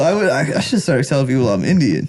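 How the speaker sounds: ordinary talk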